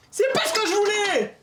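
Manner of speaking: high, loud exasperated cry; frustrated shout. Real, not acted. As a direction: yell out the frustration. Frustrated at failed repair.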